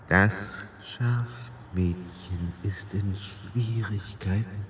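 a cold creature voice, drawn out and eerie; hissing echoing voice. A narrator's reading. The queen in Tiffany's body taunting Roland, slow soft hiss. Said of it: "mit einer zischenden Stimme voller unangenehmer Echos".

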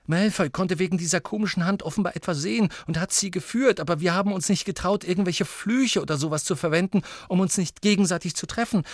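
put-on high, urgent girl's voice; breathless-paced, high witness account; Ginny. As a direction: tell it excitedly, pitched well up.

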